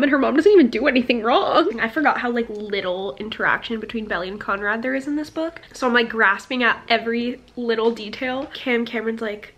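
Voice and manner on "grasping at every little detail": usual speaking voice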